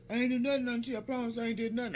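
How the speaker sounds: high, urgent denial; imitated defensive protest. Uncle swearing to auntie he did nothing, imitated protest. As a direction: mimic him protesting his innocence.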